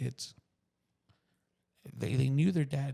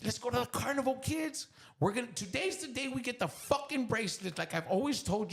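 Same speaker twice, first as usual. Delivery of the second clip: put-on high-pitched, eager dad rallying his kids; retold carnival hype; excited announcement; 9 semitones higher than usual. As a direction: burst out with it, pitched way up.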